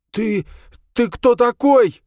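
high, loud, slow stammering cry; blurted in fright. A narrator's reading. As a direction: stammer it out in terror. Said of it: "выпалил Белобрысый". (выпалил в страхе)

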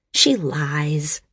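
plain reading voice

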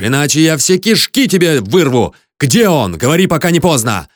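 high, heated accusations; angry tirade rising in force; blind rage. A narrator's reading. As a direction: rant at him, building to threats. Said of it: "Гнев совсем затмил мой взор".

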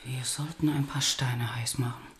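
soft whisper over the sick bed; hushed bedside suggestion. Acted. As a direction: murmur so as not to wake him. Tending unconscious patient at bedside.

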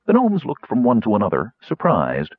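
ordinary delivery, nothing marked

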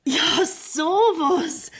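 slow, high, pleased astonishment; delighted surprise. Acted. Widow delighted to receive her interest back, slow and high. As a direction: marvel at the money, drawn out.

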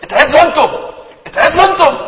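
high and loud, rising outrage on each "اتعدلوا"; indignant retort. Retold or imitated. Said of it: "فبص له كده قال له".

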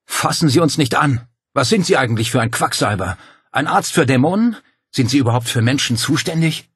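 loud, high-pitched indignant questions; angry challenge. A narrator's reading. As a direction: round on the doctor in outrage. Angry man confronts the doctor.

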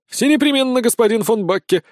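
high, keen assurance; eager deference. Acted. Sergeant bows to his employer, eagerly deferential. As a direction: promise it like an obliging servant. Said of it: "поклонившись, произнёс Сисюля".

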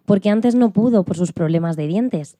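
usual speaking voice

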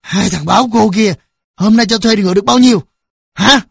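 an angry father's loud, sneering interrogation; menacing sneer. A narrator's reading. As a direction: demand an answer with contempt. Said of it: "cười gằn".

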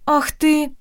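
sharp high outraged cry; indignant outburst. Acted. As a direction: burst out in outrage. Heroine lunges at the prince.

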